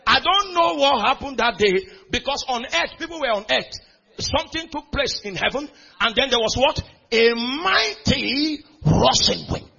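loud, high fervent preaching; preaching at full force. Acted. Preacher to congregation, loud fervent preaching. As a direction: shout it out to the hall.

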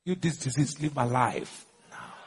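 slow, low, quiet banishment; measured command. Shown as a demonstration. Preacher ordering disease out of his life, slow quiet command. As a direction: speak low and unhurried, as an order.

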